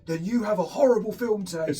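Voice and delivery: lightly voiced old man delivering a punchline; mock-solemn old man's put-down; imitating elderly man. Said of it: "He went".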